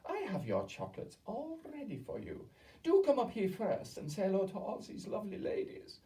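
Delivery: high, sweet, syrupy witch voice. A narrator's reading. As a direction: coax the boy like dripping syrup. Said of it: "Her voice was quite different now".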